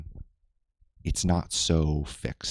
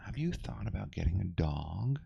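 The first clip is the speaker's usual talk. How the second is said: mock medium advice; 3 semitones higher, 9 dB quieter than usual.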